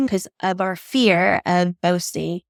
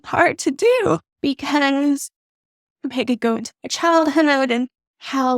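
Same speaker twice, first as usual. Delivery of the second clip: fighting tears while speaking; choked up; 5 semitones higher than usual. Real, not acted. Speaker remembering her younger self, tearfully choked up. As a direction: voice rising high with held-back tears. Moved to tears recalling herself.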